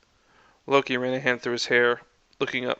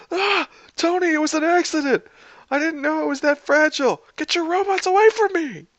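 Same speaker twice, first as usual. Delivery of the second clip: very high, loud pleading; panicked yell; 5 dB louder than usual. A narrator's reading. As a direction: cry out in alarm while under attack. Steve attacked by Tony's robots.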